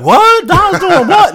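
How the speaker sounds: high, loud disbelieving "What?"; mock shock. Host imitating listeners shocked at the story, high and loud. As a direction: play a scandalized listener.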